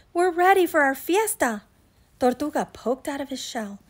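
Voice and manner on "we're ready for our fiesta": high, loud, excited rabbit; bouncy party excitement; excited announcement. Acted. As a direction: announce it eagerly, voice lifted. Rabbit hops up and down.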